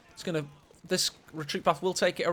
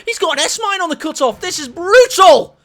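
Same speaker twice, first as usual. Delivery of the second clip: gasping, much higher and louder, building to a yell; excited caster hype; 10 semitones higher, 14 dB louder than usual. Real, not acted. Caster yelling as the S-mine hits the cutoff. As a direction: explode with excitement at the play. Caster hypes a brutal play.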